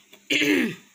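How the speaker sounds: loud rough clearing of the throat. Real, not acted. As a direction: clear a sore throat hard.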